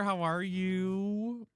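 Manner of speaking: high, slow, drawn-out "How are you?"; stretched sing-song greeting. Real, not acted. Greeting a viewer twice.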